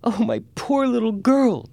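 very high, drawn-out anguished cry; screaming mother. A narrator's reading. Mother cries for her child locked in the safe, screaming. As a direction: wail in hysterics, stretching the words. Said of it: "screamed her mother".

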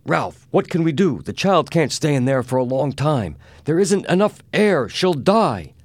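high, loud frantic appeal; panicked pleading. A narrator's reading. Mister Adams begs Ralph as the child runs out of air, panicked. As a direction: plead in rising alarm, pitch way up.

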